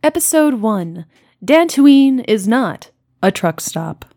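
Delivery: ordinary talk, nothing marked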